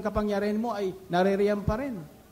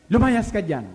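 loud order; 3 semitones higher, 7 dB louder, slower than usual. Retold or imitated. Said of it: "inutos niya uli".

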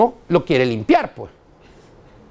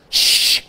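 air syringe hiss. Shown as a demonstration.

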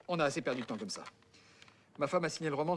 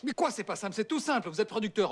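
impatient; 6 semitones higher, 4 dB louder than usual. Acted. Impatient correction of Pignon.

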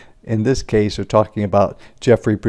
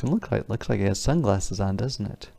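usual speaking voice

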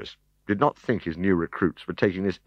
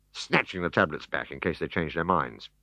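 plain reading voice

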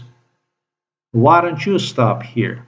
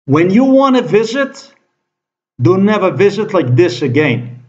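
angry warning; 7 semitones higher than usual. Real, not acted.

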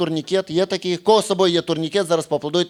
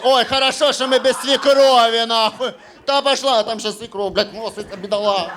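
higher voice, gleeful; mock gossiping woman; 4 semitones higher, 3 dB louder than usual. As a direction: play a relieved wife. Глядачка радіє без свекрухи. (весела жіноча репліка, вища)

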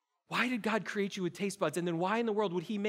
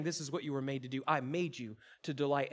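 a hint of God speaking softly, lower and softer; God's gentle voice; 8 semitones lower, 5 dB quieter than usual. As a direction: voice God's words tenderly and quietly. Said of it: "he's saying".

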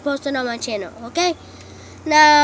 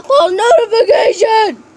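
hyped shout; 6 semitones higher, 6 dB louder than usual. Real, not acted. Hyped channel outro call.

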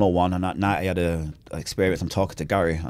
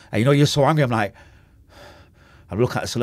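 ordinary talk

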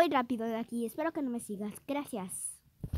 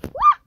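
startled scream; 15 dB louder than usual. Real, not acted. Sudden fright in game.

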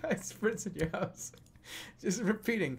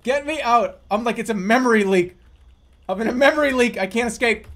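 loud, high, fast panic; laugh-panic as the game glitches; panicked laughing; 6 semitones higher, 12 dB louder, faster than usual. Real, not acted. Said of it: "I'm gonna blue screen".

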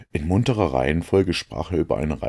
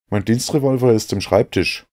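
higher, louder voice for Watson; Watson's voice; 8 semitones higher, 6 dB louder than usual. A narrator's reading. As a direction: lift the voice to play Watson. Watson answers Holmes in dialogue.

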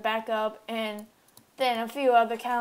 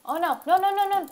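high, loud, rapid noes; real gamer panic, pitched up; panicked protest; 9 semitones higher, 5 dB louder, faster than usual. Real, not acted. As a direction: cry out as danger closes in. Player about to be killed in game.

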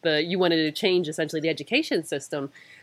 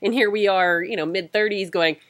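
normal talking voice

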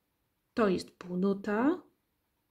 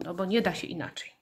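ordinary delivery

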